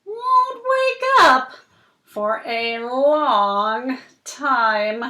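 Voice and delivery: slow, dramatic picture-book reading; reading to children. Acted. Reading the fish story to Cooper, slow and dramatic. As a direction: draw the little listener into the story.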